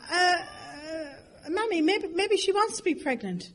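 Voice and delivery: high, hesitant little plea; acted timid daughter. Daughter to mother about pregnant stranger, timid suggestion.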